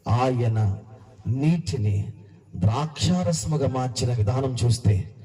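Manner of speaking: ordinary talk, nothing marked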